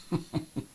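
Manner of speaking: quick breathy he-he; little mischievous laugh; short soft titter. Acted. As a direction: titter at your own dark joke. Jokes about poisoning nobody yet.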